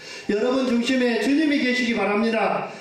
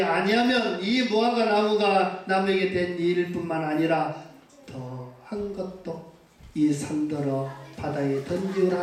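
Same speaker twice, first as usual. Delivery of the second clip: low, fading solemn quote of Jesus; scripture quote; 4 semitones lower than usual. Acted. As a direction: read it gravely and let it sink. Quoting Jesus' words. (낮고 엄숙하게 잦아드는 인용)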